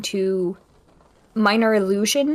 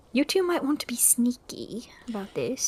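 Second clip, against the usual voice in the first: whisper; 6 dB quieter than usual. Acted. Urging sneaking.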